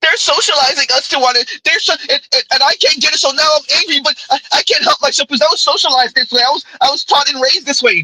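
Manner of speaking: high, put-on complaining voice; mock whiny woman. Mocking women who blame socialization, high whine.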